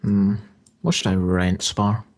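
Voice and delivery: ordinary talk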